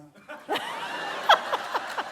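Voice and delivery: real, loud, high laugh bursting out; loud burst of laughter. Real, not acted. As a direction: break into a big open laugh. Laughing at his own Oprah joke.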